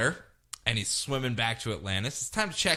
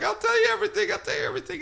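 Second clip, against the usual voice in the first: very high, desperate babble; high terrified begging; panicked confession; much faster than usual. Retold or imitated. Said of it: "He's like".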